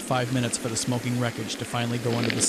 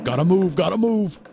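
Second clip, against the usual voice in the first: loud, high-pitched shouted urging; urgent yell; 7 semitones higher, 6 dB louder than usual. A narrator's reading. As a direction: yell it as you race past.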